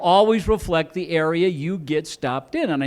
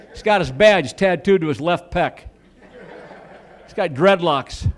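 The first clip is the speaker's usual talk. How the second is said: normal talking voice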